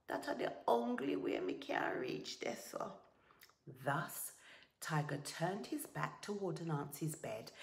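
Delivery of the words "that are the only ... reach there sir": breathy, feeble resignation; weary sigh. A narrator's reading. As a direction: give in weakly on a sighing breath. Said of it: "Anansi sighed".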